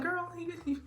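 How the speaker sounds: flustered denial. Retold or imitated. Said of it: "I'm like".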